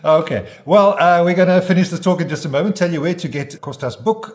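radio host voice. Acted. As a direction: hype the break like a DJ. Radio host segment tease.

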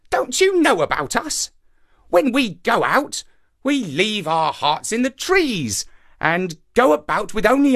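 high-pitched indignant monkey; crafty monkey, bluffing. A narrator's reading. Monkey explains hearts are left in trees, crafty bluff. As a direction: bluff boldly in a high character voice.

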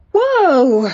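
sharp high startled cry; sudden alarmed whoa. Acted. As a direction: pull up a startled pony. Girl startled by shying pony.

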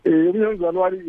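ordinary delivery

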